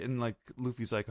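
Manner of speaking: ordinary talk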